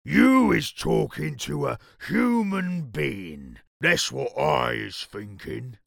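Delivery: loud, slow, accusing creature voice; booming giant. A narrator's reading. Creature accusing the BFG of talking to humans, booming. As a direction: boom each word slowly at the culprit.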